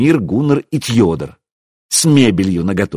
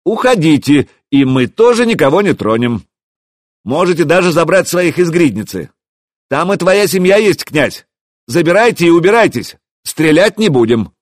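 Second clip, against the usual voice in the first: high, raised, firm call; calling out terms; 7 semitones higher, 4 dB louder than usual. A narrator's reading. Hero making a counter-offer to the prince, calling out. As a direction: shout terms across the yard.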